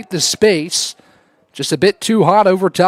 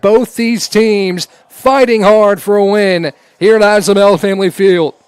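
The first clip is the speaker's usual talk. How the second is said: ordinary delivery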